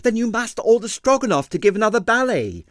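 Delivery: high, urgent, desperate pleading; distressed dancer urging the inspector; pleading ballerina. A narrator's reading. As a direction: beg him to understand, voice up high. Ballerina demands.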